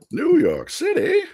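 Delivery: very high, drawn-out mock ad catchphrase; salsa commercial impression. Co-host echoing the salsa commercial catchphrase, hammed-up ad voice. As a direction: echo the ad line high and slow. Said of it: "the fucking salsa commercial".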